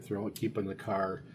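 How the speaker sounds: ordinary delivery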